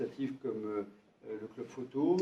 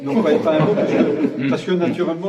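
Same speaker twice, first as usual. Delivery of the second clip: louder, laughing through the protest; laughing denial; 6 semitones higher, 16 dB louder than usual. Real, not acted. Mayor laughing off the joke that he fired Jean-Luc, loud and laughing. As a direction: laugh it off while denying.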